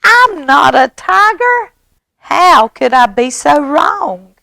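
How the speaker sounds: high, loud wail of disbelief; shocked mouse. Acted. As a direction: cry out in staged dismay. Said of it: "He's going".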